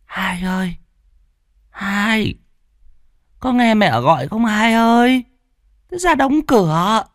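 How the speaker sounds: put-on very high, frail old woman calling out; old mother calling. A narrator's reading. Mother calls her second son to shut the window, high and frail. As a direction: plead with the younger son to answer. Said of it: "Bà già lại gọi thằng em".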